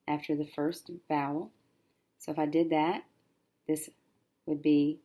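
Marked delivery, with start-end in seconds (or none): none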